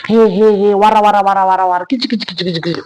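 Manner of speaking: loud, rising rant of meaningless wara wara words; mock angry rant. Father rants at his daughter about money, mock angry. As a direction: ham up the ranting father, then let it fade.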